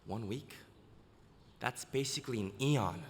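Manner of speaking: hushed, softly voiced squirrel tempter; lightly acted soft aside; squirrel's whisper. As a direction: murmur it softly, like a sly little voice in the head. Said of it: "that little squirrel climbs out ... and whispers".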